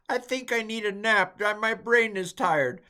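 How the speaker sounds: high, loud, worn-out whine; tired whine. Pretend. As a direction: whine like your brain is fried. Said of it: "I feel like".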